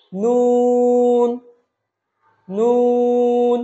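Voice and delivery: নুন with a long level vowel; four-alif stretch. Shown as a demonstration. Teacher models for repetition, নুন drawn out. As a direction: sustain নুন strongly on one note. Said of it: "আমরা এটাও চার আলিফ টানবো".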